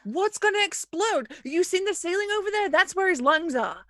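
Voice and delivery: high-pitched, indignant arguing back; incredulous retort. Acted. Hyde argues back at crewmate.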